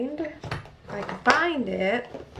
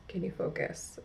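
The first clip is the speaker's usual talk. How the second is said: breathy whisper, much softer; hushed aside to the camera; 9 dB quieter than usual. Real, not acted. Coaxing camera to focus again.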